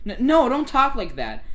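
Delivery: loud, high, stammered plea; acted dismay, stumbling on the first word; alarmed protest. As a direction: cry out in protest, drawing it out. Character protests in acted dialogue.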